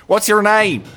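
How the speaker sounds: eager call. Acted. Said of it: "be like".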